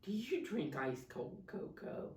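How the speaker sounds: usual speaking voice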